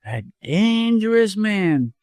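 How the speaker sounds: high, slow, savoured boast; with relish. A narrator's reading. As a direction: draw the words out with relish. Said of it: "chuckled and repeated the phrase with relish".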